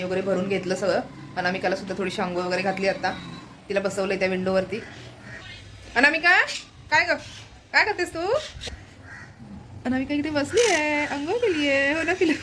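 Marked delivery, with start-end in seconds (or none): 6.0-12.3 high cooing pet talk. Real, not acted. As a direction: coo at the pet in a raised voice. Talking to pet Anamika.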